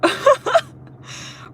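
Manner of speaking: loud squealing laugh; cackling squeal of disbelief; high shrieking laugh. Real, not acted. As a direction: burst into a shrill laugh. Laughing at the absurdity.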